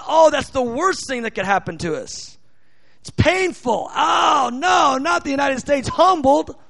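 hammed-up horrified wail, rising high; mock dismay. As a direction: play the horrified public, exaggerated. Mocks public's horrified reaction.

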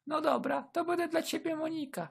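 high-pitched soft woman; high flirty woman. Acted. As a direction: agree sweetly. Escort agrees.

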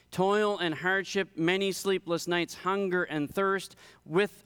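rhythmic pulpit list, each item pressed; emphatic scripture reading. A narrator's reading. Preacher reading Paul's dangers to the congregation, emphatic scripture reading. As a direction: drive through the hardships item by item.

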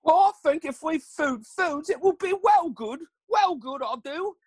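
high, slow goofy British-ish voice; silly high character voice. Acted. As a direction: play the dim inventor, pleased with himself. Gary voiced in silly accent.

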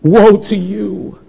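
soft, grieving woe; loving warning, drawn out and hushed; tearful rebuke. Acted. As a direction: say it gently, as if the lip is quivering. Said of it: "lip quivering as he's saying".

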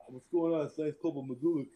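a hint of a lisping soldier; Magulik's lisp. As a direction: toss off the greeting with a light lisp. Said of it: "that's how the dude would talk".

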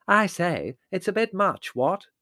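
high, silly upper-class protest; vapid young man. A narrator's reading. Vapid young man objecting to the commotion, foolish voice. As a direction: bleat it like a vacuous young gent. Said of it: "said the vapid young man".

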